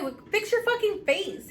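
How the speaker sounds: high-pitched mock reprimand; retold scolding. Mother acting out scolding her son, retold scolding.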